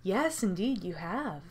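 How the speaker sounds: low, soft menacing host; villain host. Acted. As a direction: speak like a cold captor. Voices game host.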